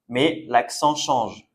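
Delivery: ordinary delivery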